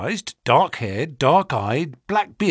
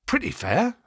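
higher-pitched, understated reply; modest; 9 semitones higher than usual. A narrator's reading. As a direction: play down your own merit.

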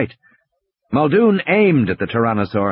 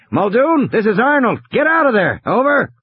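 higher-pitched, lightly voiced radio operator; urgent radio call; 10 semitones higher than usual. A narrator's reading. Arnold radios Muldoon to get away from the Tyrannosaur, urgent. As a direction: order him out over the radio, high and urgent.

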